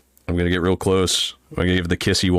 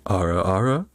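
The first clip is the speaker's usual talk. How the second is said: put-on a sly older-sister purr on "Ara, ara"; teasing ara ara; 2 semitones lower than usual. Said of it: "Let me get in the headspace".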